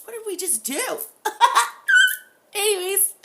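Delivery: high, loud giddy laughter; laughing. Real, not acted. After the session asking viewers, laughing hard. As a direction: crack up through the words.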